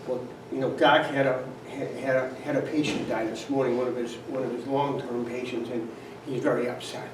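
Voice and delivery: mother-in-law softly explaining at the table; hushed explanation. Retold or imitated. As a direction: murmur it like a delicate family secret.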